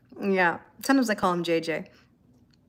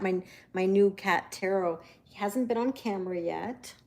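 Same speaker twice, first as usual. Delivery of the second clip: usual speaking voice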